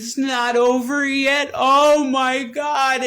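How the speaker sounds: high, loud, slow mock wail; imitating her wail. Retelling his partner refusing the breakup, high slow wailing imitation. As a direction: stretch it out like her desperate cry. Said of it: "And she says".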